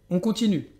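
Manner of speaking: normal talking voice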